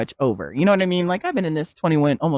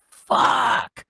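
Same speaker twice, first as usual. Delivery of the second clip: breathy, worn-out swear; exasperated curse. Retold or imitated. Woman acting out waking up on a bad day, exasperated. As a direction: let the curse out in a drained, voiceless breath.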